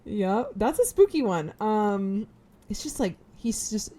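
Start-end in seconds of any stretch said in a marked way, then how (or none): none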